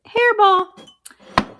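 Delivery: squeaky mischievous cat; cat creamer voice. Pretend. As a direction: play the cheeky cat pitcher.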